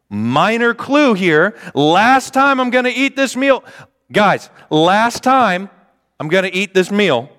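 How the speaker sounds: high, slow, overdone emphasis; exaggerated hint. Preacher spells out the obvious clue to dense disciples, high and slow.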